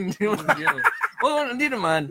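high, loud, laughing denial; laughing protest. Real, not acted. Co-host laughs off a bawdy remark, laughing.

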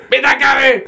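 high imitated shout of mitä kävi; mock shout. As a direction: pitch it very high like a startled cameraman. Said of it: "hän huutaa".

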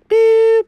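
high held mouth beep; voicemail beep. Acted. Cat playing the answering machine, high beep. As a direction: make the tone before the message.

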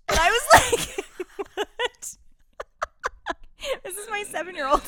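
high squealing laughter through the words; can't get it out for laughing; cracking up mid-story; laughing hard. Real, not acted. Laughing hard while telling.

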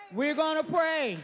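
preacher's shout to begin prayer; rising call to prayer. Acted. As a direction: proclaim it to the room.

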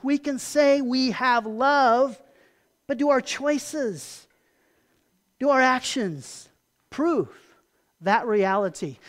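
slow, deliberate, weighted words; slow emphasis. Real, not acted. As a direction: lay out each word slowly to make the point. Slow emphatic preaching point.

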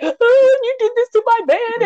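hammed-up imitation of a sobbing woman; mock crying wail. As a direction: wail it like a tearful, dramatic accuser. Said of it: "girl, she was crying".

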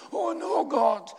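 dismayed cry. A narrator's reading. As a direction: cry out to God in protest, pitched well up. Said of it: "he cried".

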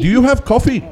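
high mock Indian accent. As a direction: call it out in a put-on Indian voice. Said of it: "he's like".

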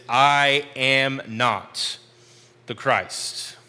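"I am not" drawn out very slowly, pause before "the Christ"; emphatic, clear statement. Retold or imitated. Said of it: "a very emphatic and very clear statement".

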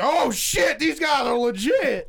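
high, loud impressed shout; hyped reaction. Acted. Imagining listeners reacting to a sponsor drop, hyped shout. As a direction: shout it like an amazed fan. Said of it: "Be like".

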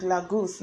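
"Lagos" as LAH-goose; mimicked Lah-goose. Trying the girl's version, "Lagos" as Lah-goose. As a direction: end "Lagos" like the word "goose". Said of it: "I can't say that".

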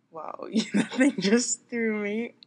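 slow, loud, laughing through the words; real laughing. Real, not acted. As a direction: thrown off and cracking up. Startled by call, cracking up.

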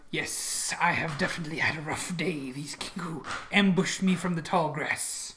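whispery character voice; half-whispered, worn-out reply; weary ambush victim. Acted. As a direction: answer in a hushed, whispered character voice. Game master answers as injured character.

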